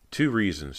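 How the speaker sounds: usual speaking voice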